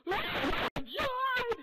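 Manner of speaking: very high, long, full-volume shriek; mock scream. Player reacting to his broken elbow, acted-out scream. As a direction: act out screaming in shock at your injury.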